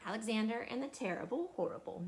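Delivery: plain reading voice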